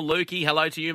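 ordinary talk, nothing marked

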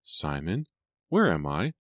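lightly groggy questions, pitch climbing at the end; mildly dazed, rising questions. A narrator's reading. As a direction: come round confused after fainting. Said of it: "she asked groggily".

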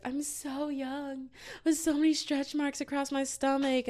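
high-pitched self-pitying whine; insecure whine. Retold or imitated. Acting out past insecure self.

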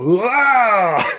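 short high mock yell as he lunges; brainwashed robot lunging at his friend, yelling; attack cry. Tank lunges at Hawk.

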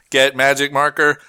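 monotone device command; robot voice. Acted. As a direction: join in as the robot. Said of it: "a robot voice".

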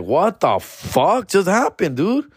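slow, high, stunned what just happened; excited disbelief. Retold or imitated. Comic tells viewers of the offer, slow high disbelief. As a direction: marvel at it, dragging it out high. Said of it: "I was super excited".

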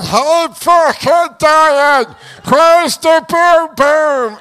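loud, drawn-out hammed-up cries for help; winded panic. Winded boxer begging for help from the canvas, mock-panicked wail. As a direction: wail slowly like a man sure he's dying. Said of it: "And I'm going".

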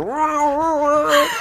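high, loud vocal guitar wail. Retold or imitated. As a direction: imitate a screaming guitar riff with your voice. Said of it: "hearing my guitar going".

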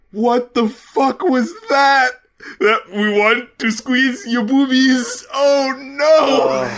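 high, slow mocking parody; mocking creepy intro. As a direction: parody the creepy skit, laughing. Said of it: "a similar feeling".

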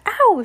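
sharp high yelp of pain. A narrator's reading. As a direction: cry out as if bitten. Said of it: "she shouted".

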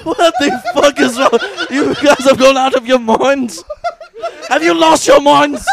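high, hammed-up yelling through laughter; mock outraged shout. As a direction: bellow in comic disbelief at the top of your voice. Acted outrage of resurrected founding fathers.

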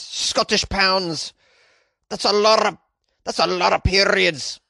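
slow, hammed-up Scottish accent; mock Scottish accent. Host marvelling at the cost, mock Scottish accent. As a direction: roll it out in a broad Scottish accent.

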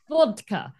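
put-on Russian accent.

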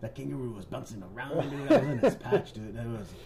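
fast, laughing mock coworker; imitating coworker. Host keeps imitating the coworker's wild dream story. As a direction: ham up the silly dream story. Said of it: "your coworker's like".

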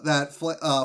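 usual speaking voice

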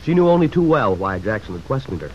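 ordinary talk

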